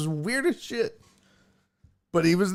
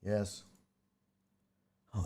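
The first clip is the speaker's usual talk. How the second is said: usual speaking voice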